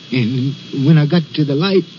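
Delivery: ordinary talk, nothing marked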